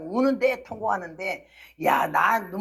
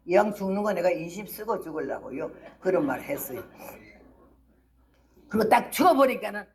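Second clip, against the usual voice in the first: ordinary talk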